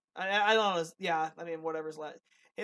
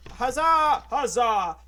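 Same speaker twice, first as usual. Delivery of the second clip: loud, hammed-up double huzzah; mock cheer; 4 semitones higher, 11 dB louder, much slower than usual. Co-host echoing the cheer for Lauren, loud mock cheer. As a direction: belt it out as a playful cheer.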